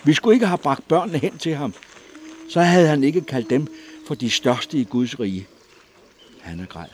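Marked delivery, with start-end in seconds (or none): 0.0-5.5 high, loud anguished cry; louder, higher, blaming herself; shouting. A narrator's reading. Mother cries out her guilt to the crowd, loud. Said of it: "råbte hun".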